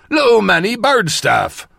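put-on old watchman. A narrator's reading. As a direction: echo the old countryman's voice. Narrator quotes watchman's words in voice.

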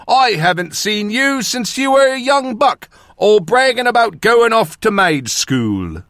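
put-on high, excited old northern countryman; exclaiming. A narrator's reading. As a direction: gush at someone not seen for years. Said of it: "he exclaimed".

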